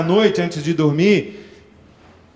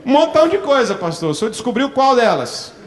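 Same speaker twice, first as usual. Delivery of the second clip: shameless churchgoer boasting his sins; cheeky believer; 7 semitones higher than usual. Retold or imitated. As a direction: brazen believer owning up with a grin. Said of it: "crente cara de pau".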